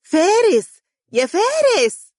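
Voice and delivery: put-on high, loud child's call; a little girl yelling down from the balcony; little sister calling her brother from above; child shouting. A narrator's reading. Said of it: "صوتا طفوليا يصيح به".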